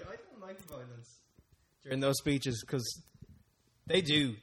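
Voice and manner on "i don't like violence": slow near-whisper, much softer; a small, hushed mock protest, slowed down; mock timid protest. Mocking his own squeamish inner voice, soft and slow. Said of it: "kinda like".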